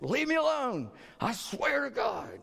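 high-pitched exasperated outburst, last words drawn out. Acted. Grumbling at brother and sister under persecution, exasperated. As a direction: act fed up, pitch jumping way up. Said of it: "One may be you're just exasperated".

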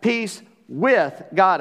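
"with" drawn out slowly between pauses; stress on "with". Real, not acted. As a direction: set "with" apart, heavy and deliberate. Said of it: "I'm emphasizing that word".

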